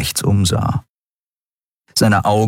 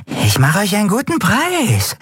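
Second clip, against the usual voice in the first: high, youthful haggler; wheedling drug dealer; 13 semitones higher than usual. A narrator's reading. Dealer bargains with two strangers, high and wheedling. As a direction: sweeten the deal in a high wheedle.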